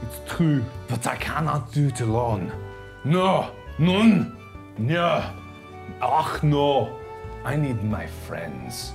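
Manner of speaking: hammed-up French art host accent; fake French accent. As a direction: play a pompous French artist, thick mock accent. Hosts in a put-on French accent.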